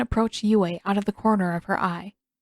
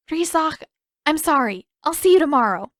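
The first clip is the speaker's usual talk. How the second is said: hurried apology; 7 semitones higher, 4 dB louder than usual. A narrator's reading. Hurried goodbye.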